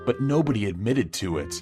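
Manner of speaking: ordinary delivery, nothing marked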